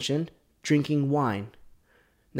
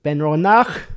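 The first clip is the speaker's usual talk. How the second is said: loud attempt at an unknown accent, rising on Ronak; put-on accent; 10 dB louder than usual. Shown as a demonstration. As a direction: try Ronak in an overdone foreign accent, loudly. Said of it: "what accent is that".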